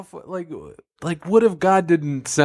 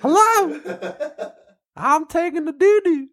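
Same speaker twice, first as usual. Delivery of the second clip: much higher, rushed at end; high God voice; 12 semitones higher than usual. Acted. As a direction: say it high and annoyed.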